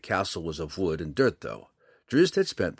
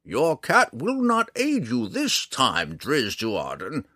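high, loud, slow warning easing off on the name; roared threat; 6 semitones higher, 4 dB louder, slower than usual. A narrator's reading. Old ranger with bow raised warning the drow, roaring. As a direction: warn him grandly and slowly, pitched high. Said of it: "Montaleo roared".

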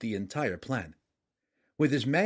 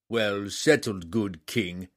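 slow grudging king; slower than usual. A narrator's reading. Giant king concedes.

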